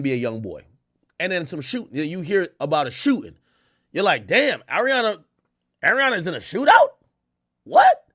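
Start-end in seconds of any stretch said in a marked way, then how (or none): 4.3-8.0 high, incredulous reaction, ending in a loud squeak; acted disbelief. Fan hearing about [name] in a shooting, incredulous. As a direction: play shocked, leaping up on the last word. Acting out fans' shock at news.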